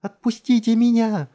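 high, drawn-out, loud scream; frantic screaming. A narrator's reading. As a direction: scream wildly from below. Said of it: "истошно заорала снизу Джессика". (истошный протяжный вопль)

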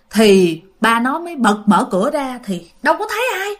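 ordinary delivery

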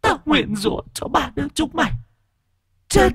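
put-on high eerie possessed child voice; shrill shriek. A narrator's reading. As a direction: hiss the curse in a shrill, ghostly voice. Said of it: "thằng Sình rít lên the thé".

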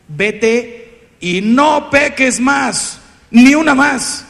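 slow and loud, building; stern command. Retold or imitated. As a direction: deliver a grave, firm command. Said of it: "lo que le dijo Jesús".

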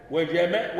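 normal talking voice

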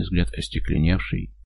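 ordinary delivery, nothing marked

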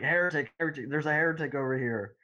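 high-pitched, mild retold alarm; mock scream. Scared guy alerting the knights, mild mock scream. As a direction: imitate a panicked shout without much volume. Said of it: "starts screaming and runs back".

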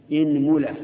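إنملة with i on the hamza, said out loud; pronunciation demo. Shown as a demonstration. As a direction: start إنملة with a clear i. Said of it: "اكسر الهمزة". (إنملة بكسر الهمزة)

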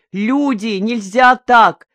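high, loud, urgent appeal; shouting over everyone. A narrator's reading. Village official appealing to the crowd, shouting over everyone. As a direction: raise your voice to overpower the crowd. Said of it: "стараясь перекричать всех".

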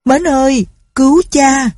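high, loud desperate cry for help; calling out loud. A narrator's reading. Old father crying out to his son for help, loud and high. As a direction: shout for your son to save you. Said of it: "nói to".

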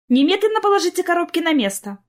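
high, loud stern scolding. A narrator's reading. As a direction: order them to put it back. Mother scolding the twins.

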